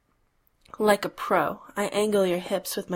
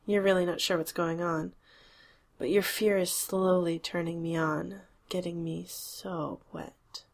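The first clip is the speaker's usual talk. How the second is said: usual speaking voice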